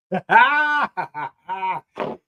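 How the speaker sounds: long laugh in bursts; hearty laugh. Real, not acted. Laughing at a listener's word.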